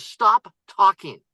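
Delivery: slow, emphatic retold order; stern command. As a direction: halt someone, one weighty word at a time. Investor cuts off the pitch.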